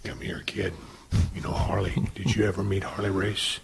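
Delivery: put-on low, hushed whisper of an old champion; Harley Race's quiet voice. Imitating Harley Race calling over a kid, low whisper. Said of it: "Harley never talked any louder than this".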